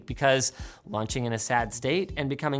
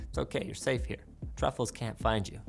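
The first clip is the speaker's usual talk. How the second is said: soft reassuring whisper; 6 semitones lower, 11 dB quieter than usual. Acted. Comforting hiding child.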